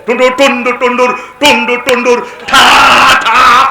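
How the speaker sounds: rolling rat-a-tat ending in loud bursts; loud imitated noises. Preacher imitating noises for the crowd, loud imitated noises. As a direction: shout out pounding sound effects.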